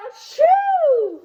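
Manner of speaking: loud, high hammed-up achoo; big sneeze. Storyteller acts out the wolf's giant sneeze. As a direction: blast out a huge fake sneeze.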